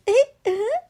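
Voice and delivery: put-on high, loud baby noises; baby babble. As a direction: squeak like a little baby. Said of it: "And the baby says".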